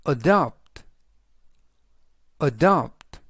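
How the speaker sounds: adopt, said carefully as a-DOPT; teacher modelling adopt; model pronunciation. Shown as a demonstration. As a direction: say adopt clearly for repetition. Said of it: "adopt"라고 발음하시면 되겠습니다".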